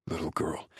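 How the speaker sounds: low, soft male voice; tender male lover. A narrator's reading. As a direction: murmur it gently to his tearful lover. Tender male lover after her tears.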